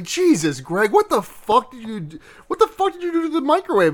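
playing an appalled mother telling someone off; exasperated scolding. Mother scolding over exploded chicken.

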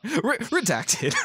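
high, slow giggling repeat; laughing. Real, not acted. Host laughing at a redacted panel, giggling. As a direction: laugh through the word.

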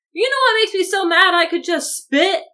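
high, loud, overplayed exasperation; mock fuming. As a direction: quote it as a worked-up teen. Repeats Jacob's angry line.

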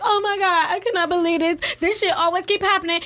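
mock exasperated complaint. Recalling her past negative self, mock exasperated complaint. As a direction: imitate your old self griping, pitch lifted.

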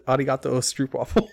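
amused repeat of a mock yell, not shouted; laughing self-quote. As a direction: repeat your own gag through laughter. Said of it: "I just, I just yelled".

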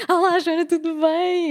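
high, over-eager greeting; mock starstruck greeting, pitch jumping up; zero calm. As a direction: gush a hello with no composure at all. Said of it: "Eu fui com zero calma".

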